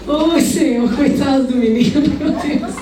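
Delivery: laughing through the words. Real, not acted. Preacher laughing to the congregation about her own school forgeries.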